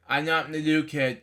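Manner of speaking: ordinary delivery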